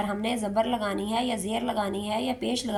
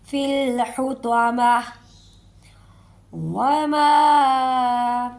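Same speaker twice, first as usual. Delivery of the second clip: slow stretched tilawat, louder; Quran recitation; 5 dB louder, much slower than usual. Shown as a demonstration. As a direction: draw out the madd. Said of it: "recitation demo". (مد کھینچ کر تلاوت)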